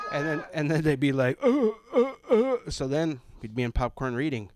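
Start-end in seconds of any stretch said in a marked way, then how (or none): 1.4-2.6 high, loud stammering taunt; mocking stammer. Bullies mocking him with a high stammer. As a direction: squeak out a stammer to mock the fat kid.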